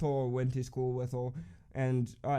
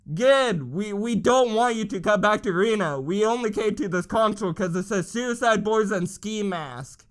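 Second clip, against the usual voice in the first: mock crowd retort; 6 semitones higher, 5 dB louder than usual. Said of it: "we're like".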